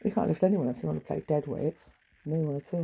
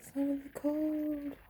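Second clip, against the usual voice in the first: higher, slowly drawn-out echo; musing echo of her own words, high and slow; 8 semitones higher, 6 dB quieter, much slower than usual. Shown as a demonstration. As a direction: repeat it slow and high, savouring it. Repeats the phrase to herself.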